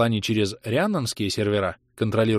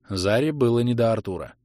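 ordinary delivery, nothing marked

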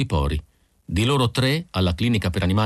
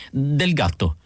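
plain reading voice

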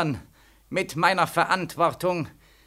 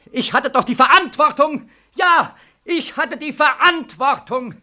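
anguished outcry; 8 semitones higher, 5 dB louder than usual. Acted. Soldier insists to the colonel that he bore the responsibility, crying out. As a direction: cry out under the guilt.

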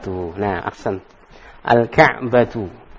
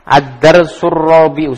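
slow emphatic title; 3 semitones higher, 6 dB louder, slower than usual. Shown as a demonstration. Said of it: "teacher repeats lesson title".

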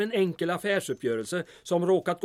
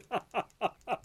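quiet trailing chuckle; a last soft laugh to himself; 6 semitones higher, 7 dB quieter than usual. Acted. Mayor still amused by his own joke, soft chuckle.

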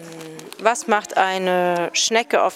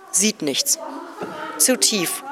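ordinary talk, nothing marked

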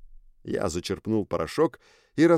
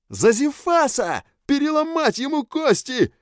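very high, loud creature yell; 5 dB louder than usual. A narrator's reading. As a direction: scream it as a wild gang kid. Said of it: "орал один".

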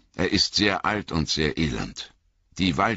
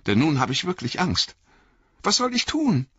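put-on much higher, pleading young hobbit voice; frightened Frodo; 12 semitones higher than usual. A narrator's reading. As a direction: beg your old friend for help, scared.

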